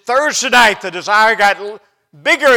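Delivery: high, very loud retelling; emphatic preaching shout. Retold or imitated. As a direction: shout it with rising force. Preacher builds the retold story.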